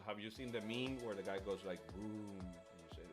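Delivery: usual speaking voice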